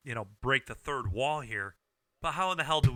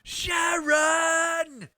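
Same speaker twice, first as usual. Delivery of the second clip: much higher, loud bellowing yell; 6 dB louder, much slower than usual. Retold or imitated. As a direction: holler a wife's name across the house. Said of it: "Do you know what it sounds like when".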